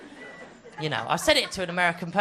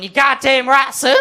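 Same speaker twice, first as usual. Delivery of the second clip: proud dad; 7 semitones higher, 4 dB louder than usual. Retold or imitated. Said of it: "With his dad next to him going".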